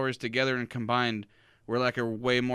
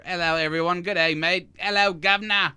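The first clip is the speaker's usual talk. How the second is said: a hammed-up British accent, raised and loud; British accent attempt; 6 semitones higher, 7 dB louder than usual. Cohost mocks the host's friend in a loud British accent. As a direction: put on a bad British accent for your friend. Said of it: "that was my attempt at a British accent".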